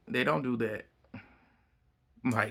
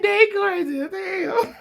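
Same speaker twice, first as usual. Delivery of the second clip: high, slow, squealing laugh; 5 dB louder, slower than usual. Real, not acted. Host telling viewers the pair are crazy, squealing with laughter. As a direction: wheeze it out through laughter.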